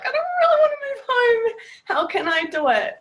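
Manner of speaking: high, loud, near-tearful wail; a voice cracking into a cry; distressed cry. Retold or imitated. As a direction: relive the panic of having to move home. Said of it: "And I was like".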